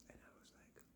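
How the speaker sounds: breathy whisper, no voice at all; whispered aside. Real, not acted. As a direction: drop to a secret whisper. Stunned by friend's remark.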